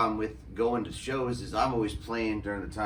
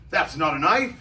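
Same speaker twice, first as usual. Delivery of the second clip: loud, higher mock Australian accent; Crocodile Dundee; 5 semitones higher, 8 dB louder than usual. Quoting the film to an Australian knife maker, Australian accent. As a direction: do a movie Aussie, loud and pitched up. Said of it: "Crocodile Dundee".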